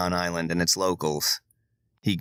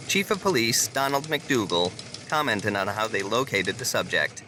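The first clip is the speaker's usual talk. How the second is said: crisp evening news delivery; news anchor read; 3 semitones higher than usual. Acted. Anchor reporting the toilet incident, news read. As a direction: read it like the nightly bulletin.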